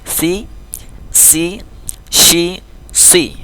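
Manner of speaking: "Si, si, shi, si": lisped, hissed, hushed, then rounded; contrasting sibilants with i. Shown as a demonstration. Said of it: "wajib beda bacanya".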